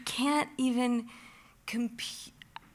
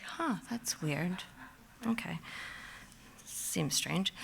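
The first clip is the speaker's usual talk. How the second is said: puzzled murmur; 10 dB quieter, slower than usual. Retold or imitated. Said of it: "And I was like".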